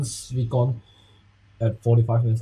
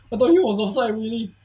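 excited disbelief; 10 semitones higher than usual. Real, not acted. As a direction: say it again in disbelief.

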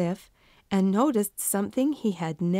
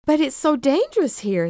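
pleading; 7 semitones higher, 5 dB louder than usual. Acted. Pleading with his family.

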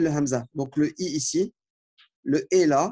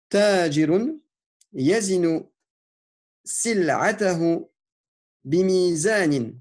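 slow, deliberate dictation of Arabic words; careful teacher dictation with pauses between words; slower than usual. Shown as a demonstration. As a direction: dictate each word slowly so students can write it. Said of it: "l'autodictée que je vous lis".

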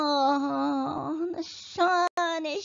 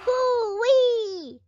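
elephant trumpet imitation; 6 semitones higher than usual.

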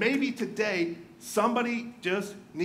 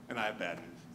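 much lower and softer, ominous drop; grim bad news; 13 semitones lower, 10 dB quieter than usual. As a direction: let the voice sink for the bad news.

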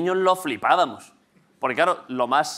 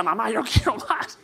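high, slow mock kid voice; put-on little kid pleading; child begging mum; 6 semitones higher, slower than usual. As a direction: whine for more like a greedy child. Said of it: "Los niños en casa".

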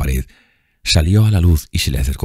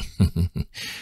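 grim chuckle; 3 semitones higher, 7 dB quieter than usual. A narrator's reading. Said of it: "con una horrible mueca".

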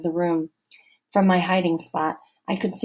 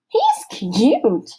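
a light, higher giggled line; giggling; 5 semitones higher, 5 dB louder than usual. A narrator's reading. Girl giggling over the boys, higher and giggly. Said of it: "some girl giggled".

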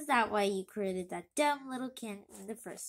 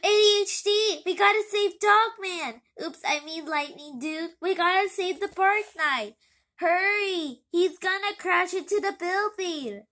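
loud, higher panicked calls; hurry, warn everyone; urgent alarm; 5 semitones higher, 7 dB louder than usual. Acted. Kids urgently call to save.

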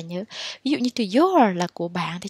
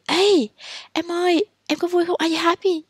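bright, high, eager asking; cheery question; 8 semitones higher than usual. Shown as a demonstration. Teacher playing a friend asking a student.

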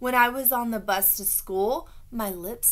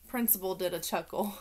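ordinary delivery

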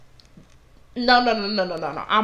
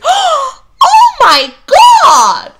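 put-on much higher, louder shocked old lady; scandalised gasp; 11 dB louder, much slower than usual. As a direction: clutch your pearls and cry out. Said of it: "all you hear is".

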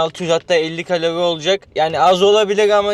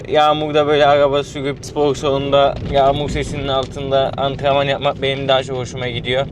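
usual speaking voice

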